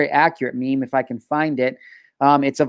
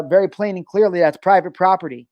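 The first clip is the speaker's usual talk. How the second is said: normal talking voice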